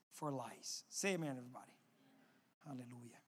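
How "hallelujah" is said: ordinary talk, nothing marked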